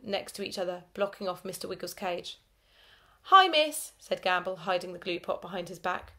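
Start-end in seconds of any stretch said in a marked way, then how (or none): 3.2-3.9 high, loud innocent chirp; cheery pupil greeting the teacher. A narrator's reading. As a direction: sound far too innocent. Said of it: "smiled as innocently as we could".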